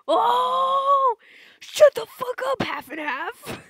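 shrieking furious outburst; furious yell. Acted. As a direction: scream at him.

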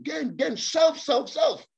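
preacher escalating "gain, gain, self, self, self." upward; mounting emphasis. Real, not acted. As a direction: climb with every repeat of "self" until the last "self." peaks high. Preacher hammering greed, rising each word.

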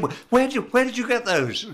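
high-pitched, stammered astonishment; astonished. Retold or imitated. Actor spots fans holding his figure, high astonished question. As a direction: blurt it out high, tripping on the first words.

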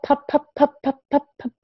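high, bouncy popping pops; popcorn pops. Shown as a demonstration. Teacher imitating popcorn popping for a child. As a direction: make each pop jump like popcorn. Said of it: "We hear pop".

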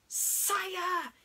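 very high snaky call; hissing snake. Acted. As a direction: hiss out an urgent warning.

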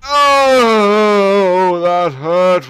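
very loud, high, long cry of pain; pained wail. Real, not acted. Gamer taking a painful hit, loud drawn-out wail. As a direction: wail out the complaint. Player hurt in game.

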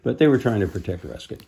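usual speaking voice

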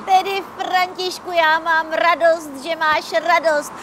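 high, loud happy chatter; bubbling with joy; excited. Acted. Happy about snow. (radostně)

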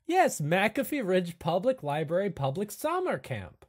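high, proud announcer-like pitch; Igor voice. Acted. Igor proudly names the summer camp, high. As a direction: trumpet the camp name with pride. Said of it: "Igor says".

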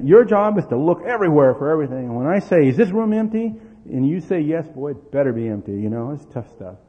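loud mock-executive briefing; stern orders. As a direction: bark the rules at a tiny kid. Father giving child strict orders.

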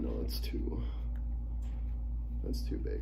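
soft breathy whisper to himself; whispered aside. Real, not acted. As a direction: mutter it under your breath as you notice the fit. Trying on a too-big headset.

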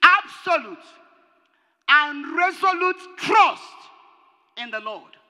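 loud, slow, emphatic pulpit point; hammered sermon emphasis; forceful preaching. Acted. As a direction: drive each word into the congregation. Preacher hammers his second point.

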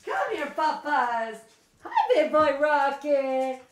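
doting dog-owner voice. Real, not acted. Dog dad greeting his papas, cooing baby talk. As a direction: coo to a dog, stretching the words.

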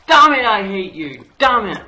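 screamed, very high and loud fury; raging. Real, not acted. Gamer who just lost to the game, raging shout. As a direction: explode in frustrated shouting. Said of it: "Sorry for raging".

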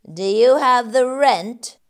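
greedy landlord voice; rat demanding rent. Acted. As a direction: press for the money. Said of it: "he says".

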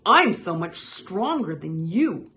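loud, boastful comeback; bragging. A narrator's reading. Toad outdoing Frog's boast, loudly bragging. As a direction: brag right back at the rival. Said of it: "bragged Toad".

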